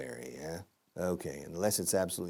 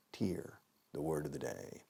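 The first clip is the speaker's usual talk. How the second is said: soft secret whisper; 6 semitones lower, 11 dB quieter than usual. Shown as a demonstration. Teacher whispering the word of the day, hushed. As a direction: lean in and whisper it.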